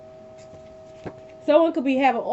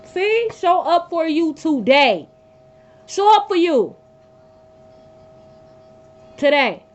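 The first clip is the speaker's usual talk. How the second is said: louder, pressing each word; emphatic urging; 5 semitones higher, 5 dB louder, slower than usual. Real, not acted. Tarot reader urging viewers, loud and insistent. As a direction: drive the message home.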